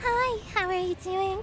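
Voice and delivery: put-on high cutesy anime girl greeting; sweet high VTuber hello. Shown as a demonstration. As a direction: chirp it like a cute streamer girl. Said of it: "So you gotta go for".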